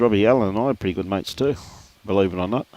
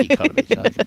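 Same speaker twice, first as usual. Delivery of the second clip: incredulous laugh; faster than usual. Retold or imitated. Mock disbelief at greedy teammate.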